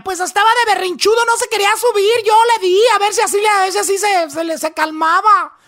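high, loud defensive whine; mother excusing herself after the accident, high and loud; mother's excuse. Retold or imitated. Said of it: "dijo ella".

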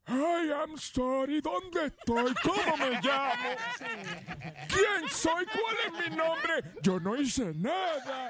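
put-on high, slow confused old man. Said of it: "haciendo voz de viejo".

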